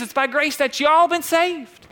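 put-on high-pitched Southern accent; Southern lady. Pastor repeats grace verse for the community, Southern lady accent. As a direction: ring it out with a Southern y'all. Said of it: "like a nice Southern lady saying y'all".

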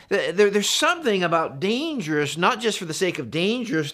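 ordinary talk

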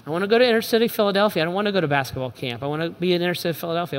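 quick, soft eager pleading; eager kid. Retold or imitated. Son asking dad to serve instead of camp, quick soft eagerness. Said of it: "my kid might look at me and say".

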